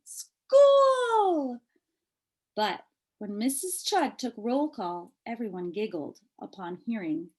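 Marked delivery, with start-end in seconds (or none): 0.0-1.6 high, delighted shout of school; excited cry. Acted. Girl on her first day, excited high cry. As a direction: squeal it with joy. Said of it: "said Chrysanthemum".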